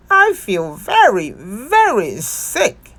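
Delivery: slow, drawn-out sick moan on the second very. A narrator's reading. Bear in bed, moaning to Missus Duck about feeling ill. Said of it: "moaned Bear".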